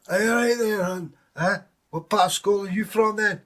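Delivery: high, loud Glaswegian banter; Glasgow accent. Retold or imitated. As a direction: call out in a broad Glasgow accent. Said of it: "a woman from Glasgow".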